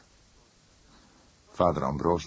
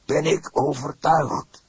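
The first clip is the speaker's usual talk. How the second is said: a hoarse, raised priest's voice, much higher and louder; 9 semitones higher, 6 dB louder, slower than usual. A narrator's reading. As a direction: push it out hoarsely with conviction. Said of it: "sprak hij hees". (hees en luid uitgesproken)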